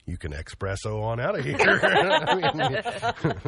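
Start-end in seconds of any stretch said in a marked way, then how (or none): 0.3-1.0 ESPRESSO said as EX-presso; mispronounced espresso. Shown as a demonstration. Host joking about the mistake, ESPRESSO said as EX-presso. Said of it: "is not espresso".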